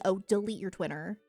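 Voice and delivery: ordinary talk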